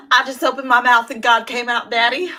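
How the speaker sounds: loud, laugh-laden quote. Retold or imitated. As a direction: quote it through barely held laughter. Quoting the character, laughing.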